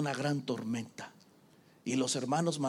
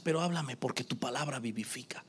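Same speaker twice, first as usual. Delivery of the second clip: retold whispered prayer; 4 semitones lower, 7 dB quieter than usual. Retelling his prayer to God.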